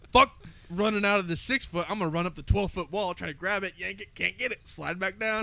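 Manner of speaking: high, loud excited play-by-play. Retold or imitated. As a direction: narrate the fail with hype. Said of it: "I'm like".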